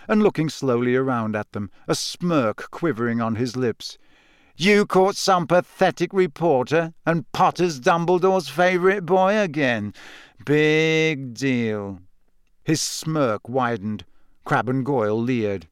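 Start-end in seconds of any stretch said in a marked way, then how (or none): 4.6-12.0 taunting, higher start sinking to a drawn-out finish; smirking schoolboy rival. Acted. Malfoy mocking the three friends, smirking taunt. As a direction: needle them with a smirk. Narrator voices sneering Malfoy.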